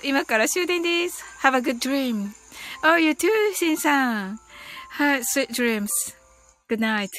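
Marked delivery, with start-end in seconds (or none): none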